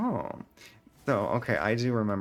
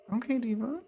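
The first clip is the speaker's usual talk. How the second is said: sassy; 13 semitones higher than usual. Real, not acted. Sassy retort to chat.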